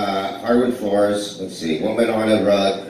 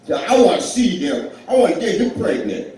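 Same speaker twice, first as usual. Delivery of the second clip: higher, laughing, lightly voiced Tracy Morgan; Tracy Morgan impression; 7 semitones higher than usual. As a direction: toss off a hammed-up Tracy Morgan line through laughter. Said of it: "Tracy Morgan did not say that".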